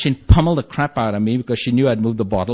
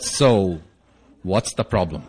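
low counselor voice, measured; 7 semitones lower, 3 dB quieter, slower than usual. Retold or imitated. Second counselor greets the couple, low and measured. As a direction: ask it low, with a pause after the first word.

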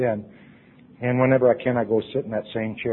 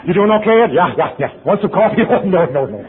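loud chummy greeting; 7 semitones higher, 8 dB louder than usual. Acted. Acting out coffee-pourer greeting.

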